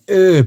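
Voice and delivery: sharp, loud, high groan of disgust; disgusted ugh. Retold or imitated. Recalling the friend's torn hamstring, a loud disgusted groan. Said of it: "I was like".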